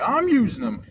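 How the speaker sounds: put-on defensive gym guy; mock attitude. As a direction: imitate someone snapping back with attitude. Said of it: "he's got an attitude".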